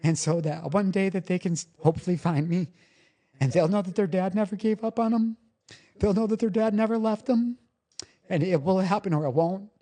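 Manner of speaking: high, choked-up resolve; real emotional. Real, not acted. Father hoping his boys will find him one day, emotional. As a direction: hold back tears, voice riding high, with pauses. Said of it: "didn't mean to get all emotional".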